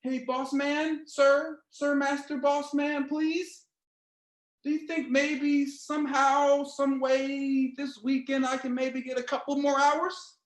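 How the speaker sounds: high, slow, pleading ask to the boss; meek worker begging for overtime; timid begging employee voice. Retold or imitated. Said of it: "I'm tired of being the one".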